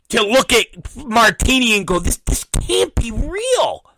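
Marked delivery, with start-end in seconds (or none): 2.0-3.8 disbelief. Retold or imitated. Acting out his disbelief at documentary.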